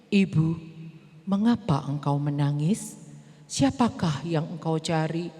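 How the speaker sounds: deep gentle voice of Jesus. Acted. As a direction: speak as the calm risen teacher. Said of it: "Dia tiba-tiba bilang kayak gini".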